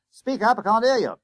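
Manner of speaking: loud, high-pitched bus conductor; demanding conductor. A narrator's reading. Conductor to a whispering schoolboy, loud demand. Conductor cannot hear the boy.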